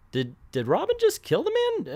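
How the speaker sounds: high-pitched stunned question; stammering high-pitched disbelief; shocked disbelief. Real, not acted. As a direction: ask in squeaky, incredulous shock. Shocked at apparent killing.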